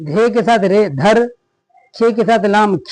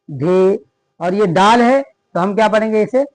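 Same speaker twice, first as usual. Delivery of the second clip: ordinary talk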